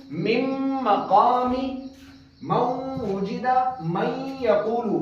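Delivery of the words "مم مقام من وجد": مم مقام and من وجد drawn out very slowly with nasal hold; slow nasal merge on each word; with ghunna. Shown as a demonstration. Teacher demonstrating idgham with ghunna, slow. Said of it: "ادغام غنہ کے ساتھ کیا".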